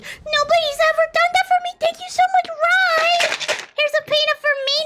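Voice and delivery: high, put-on gushing thanks; touched little character. [name] thanks viewer for the gift, high hammed-up gratitude. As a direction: gush in a high, overwhelmed character voice.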